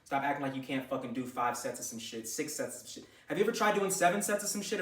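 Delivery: ordinary talk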